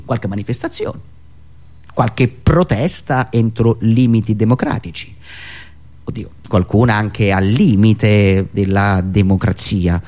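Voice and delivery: usual speaking voice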